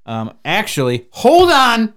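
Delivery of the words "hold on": excited interruption. Real, not acted. Sudden interruption to check phone.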